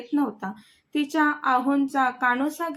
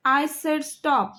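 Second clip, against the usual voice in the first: ordinary delivery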